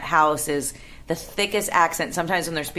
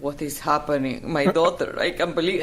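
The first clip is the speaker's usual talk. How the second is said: imitating dad's thick Bolivian accent; baffled dad in a thick Bolivian accent; father's thick accent. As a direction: put on an immigrant father's heavy Spanish-speaking accent. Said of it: "Is that how he talks?".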